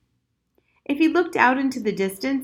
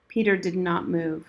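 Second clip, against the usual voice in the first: ordinary delivery, nothing marked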